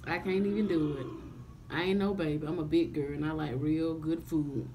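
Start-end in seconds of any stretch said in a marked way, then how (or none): none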